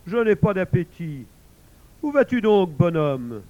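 loud, booming big cat; growling tiger voice. Acted. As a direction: boom it out like a lazy tiger. Tiger speaks loudly.

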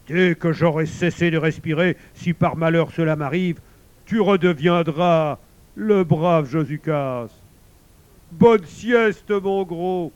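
loud, weighty pronouncement ending in hearty send-off; commanding wolf. A narrator's reading. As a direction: proclaim a promise, stretching the ends. Wolf answers, sends bear off. (loup solennel et sonore)

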